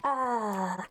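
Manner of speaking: light, drawn-out celebrity impression; Jennifer Coolidge. Streamer playing a Cinderella story character, light Coolidge impression. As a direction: imitate the actress, stretching out the words. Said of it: "You're just Jennifer Coolidge".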